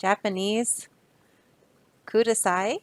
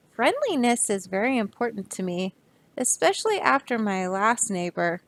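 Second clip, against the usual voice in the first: ordinary delivery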